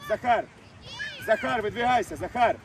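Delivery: ordinary talk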